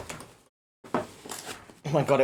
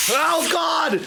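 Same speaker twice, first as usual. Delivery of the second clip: startled, high cry of dismay; a sudden high yelp as the drink sprays everywhere; shocked gasp; 10 semitones higher than usual. Real, not acted. Streamer shocked as the soda sprays his mic, high cry.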